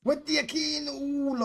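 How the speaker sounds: stretched, slow call sliding down from high; drawn-out play call. Real, not acted. Gamer calling his striker's dangerous move, drawn-out play call. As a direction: draw every word out long.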